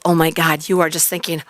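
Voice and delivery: ordinary delivery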